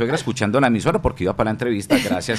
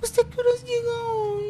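high whiny wife voice, near tears; man doing a woman's voice; 4 dB quieter than usual. Retold or imitated. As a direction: wail the name like a tearful wife. (hombre imitando voz de mujer llorosa)

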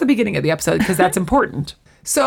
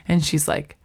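ordinary talk

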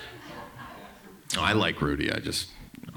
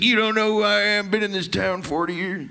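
put-on upset old local; 7 semitones higher than usual. Heckler insisting he has lived in town forty years, higher put-on voice. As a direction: play the offended regular who owns the bar. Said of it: "He's like".

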